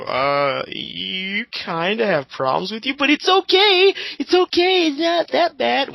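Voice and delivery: high, sugary mock-soothing voice, slowly; mock nice voice. To a bad artist, mocking fake-nice reassurance. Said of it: "be all nice about it and go".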